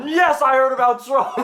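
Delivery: high shouted reply through laughter; mock yelling back. Passenger yells back.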